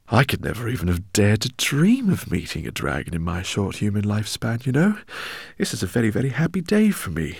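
put-on high, loud, fussy old scholar; eccentric professor. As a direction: voice him as an excitable professor. Professor speaks in story.

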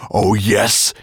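loud menacing snarl. A narrator's reading. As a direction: snarl it at your prey. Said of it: "he snarled".

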